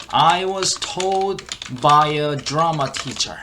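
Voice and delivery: very slow, word-by-word teaching delivery; slow model reading. Shown as a demonstration. Teacher repeats the clip for learners, slow model reading. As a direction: stretch each English word out slowly.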